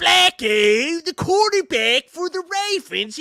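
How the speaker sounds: put-on high, loud Baltimore accent send-up; hammed-up Baltimore impression; mock Baltimore accent. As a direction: holler it in exaggerated Baltimore vowels. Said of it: "like, Baltimore people sound".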